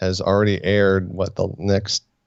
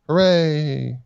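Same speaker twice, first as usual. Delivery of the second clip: mock cheer; 7 semitones higher than usual.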